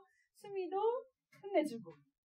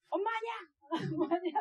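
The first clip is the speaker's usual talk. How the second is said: put-on child's excited protest; 7 semitones higher, much faster than usual. Child shrieks denial in story.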